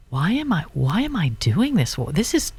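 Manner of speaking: usual speaking voice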